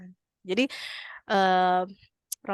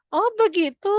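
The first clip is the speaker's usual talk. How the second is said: high, louder happy "oh"; relieved grandma; 10 semitones higher, 5 dB louder than usual. Retold or imitated. Old woman reassured about heaven, relieved and happy. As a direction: brighten up with relief. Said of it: "kata neneknya".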